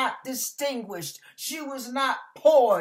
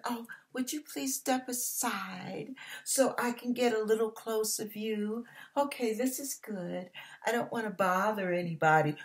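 soft, dainty mock politeness; hushed, prissy request; overly polite; 6 dB quieter than usual. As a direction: act timidly courteous, tiptoeing round people. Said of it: "She wasn't polite".

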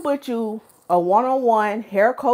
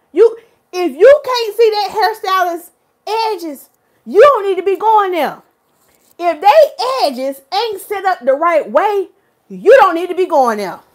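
emphatic warning; 6 semitones higher, 4 dB louder than usual. Real, not acted. Hair coach warns viewers about stylists without edges, scolding. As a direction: lay it down to the viewers.

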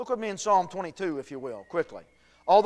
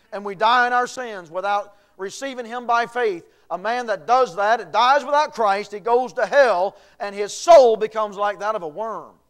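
loud, rising fire-and-brimstone preaching; full-force preaching; 2 semitones higher, 8 dB louder than usual. Acted. Preacher warning the congregation of hell, preaching at full force.

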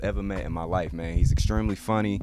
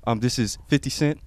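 louder, swaggering rapper voice with big laughs; 4 semitones higher, 7 dB louder than usual. Pretend. Cast member asked for his best impression, plays it loud. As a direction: hype it up like a rapper doing an interview. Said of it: "can we get your best [name] impression?".

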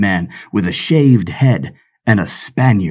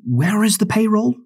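pirate voice leaping high, softer; puzzled pirate; 11 semitones higher, 4 dB quieter than usual. Acted. Pirate James finding no payroll, high puzzled question. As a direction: ask it baffled and high.

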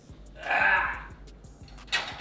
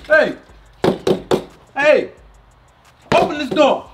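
put-on angry old man shouting; 2 semitones lower, 16 dB louder than usual. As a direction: holler at the locked door. Grandpa locked out, banging.

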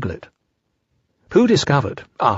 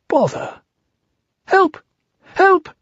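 small high voice; slower than usual. Acted. Small voice calling for help.

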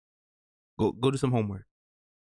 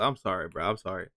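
ordinary delivery

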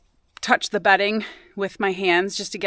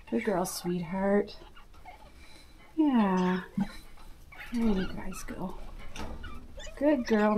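hushed praise for the mother rabbit; soft praise; 11 dB quieter, slower than usual. Real, not acted. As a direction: gently praise the doe.

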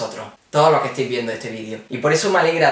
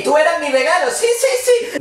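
high teasing. Acted. High flirty.

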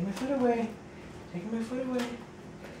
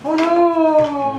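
surprised; 4 semitones higher, 7 dB louder than usual. Real, not acted. Dog falls off.

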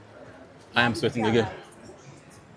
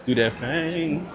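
higher, slow and savoring; slow, drawn-out mock posh; 7 semitones higher, much slower than usual. Shown as a demonstration. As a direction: stretch it out, mocking the rich.

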